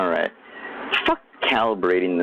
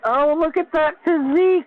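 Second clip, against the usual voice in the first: much higher, swooning fangirl gush; swooning fan. Retold or imitated. Mocking viewers swooning over [name], high fangirl gush. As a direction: gush over a rock star's body. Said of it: "You'd be like".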